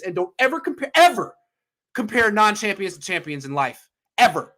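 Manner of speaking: EVER punched high and loud; fired-up lecture, ever yelled; emphatic rant. Real, not acted. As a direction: hammer every ever home. Ranting about champions.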